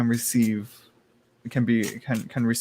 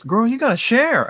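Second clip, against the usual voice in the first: high, loud mock-demanding tease; teasing demand; 11 semitones higher, 5 dB louder than usual. Reader teasing a viewer with a mock demand. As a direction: playfully demand it, much higher and louder.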